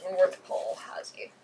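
whispered disappointment; whispering. Real, not acted. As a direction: breathe it out, let down. Dismayed at a weak draw.

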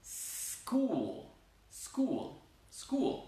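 "school" begun on a pure hissed S; S without E. Shown as a demonstration. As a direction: repeat "school", each opening with S alone, getting shorter. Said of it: "eso no".

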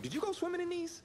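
high, incredulous squeak; can't believe how wet they are; incredulous question. Real, not acted. Disgust at damp cleats.

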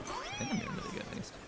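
very high, soft squeak; tiny high voice. Pretend. Player rating own game, tiny high squeaky voice. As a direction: squeak it out small.

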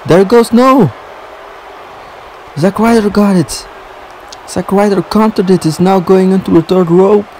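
high-pitched ringside call; startled, excited sports commentary; excited play-by-play. Real, not acted. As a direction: react to a sudden reversal, pitch jumping up. Sudden counter in the match.

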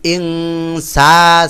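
ইনসা with the া stretched; slow, long ইনসা; elongated vowel. Shown as a demonstration. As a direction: draw out the া in ইনসা. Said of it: "টান হবে".

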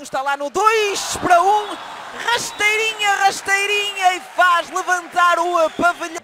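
high, loud rising goal call; rising excitement as the goal goes in; explosive goal commentary. Real, not acted.

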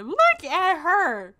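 sing-song mock gawking; drawn-out teasing. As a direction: stretch it out to tease her. Teasing a sim on screen.

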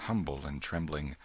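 plain reading voice

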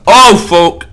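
high, loud posh British exclamation; mock British. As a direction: exclaim like a plummy Brit. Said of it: "I'm not British".

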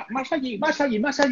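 mock the cameraman waving them closer; imitating a bossy cameraman; directing cameraman.

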